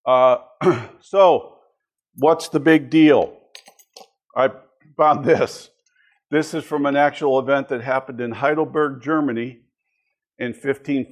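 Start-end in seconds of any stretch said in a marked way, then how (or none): none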